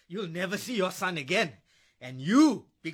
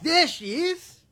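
higher and louder, a sneer of triumph; menacing discovery; 7 semitones higher, 4 dB louder, slower than usual. Acted. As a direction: sing it out when he spots her. Drunk husband spots his ex.

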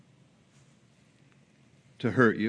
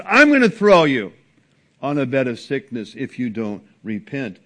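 emphatic warning; 7 dB louder than usual. Acted. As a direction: bring it down like a final verdict. Quoting again after devil aside.

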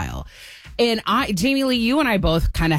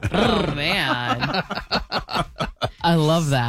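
laughing; much slower than usual. Real, not acted. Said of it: "laughing reaction".

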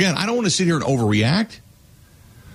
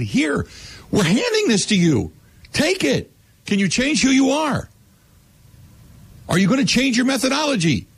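pitched way up, pressing hard; heated question; 10 semitones higher than usual. Retold or imitated. Host voicing the reporter grilling the coach, heated and high. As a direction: push the question at him like a challenge.

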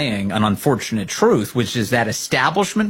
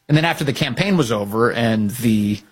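usual speaking voice

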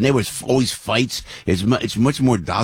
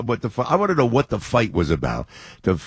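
normal talking voice

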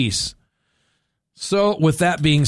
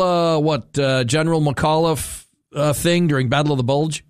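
ordinary talk, nothing marked

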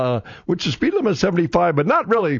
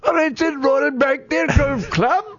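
much higher, hammed-up English accent; 10 semitones higher than usual. Host trying an English accent for a golf call, hammy. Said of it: "English accent or something?".

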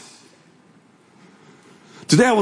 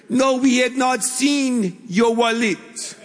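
slow, high-pitched put-on Peruvian accent; 8 semitones higher, slower than usual. Restaurant manager denies seeing the wallet, imitated Peruvian accent. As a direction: imitate a Peruvian speaker, drawn out and higher. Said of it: "That's my best Peruvian accent".

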